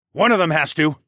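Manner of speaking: insist, leaving no room for doubt; loud and resolute; firm. A narrator's reading. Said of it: "I said firmly".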